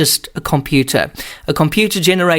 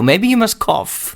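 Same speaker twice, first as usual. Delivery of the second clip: ordinary delivery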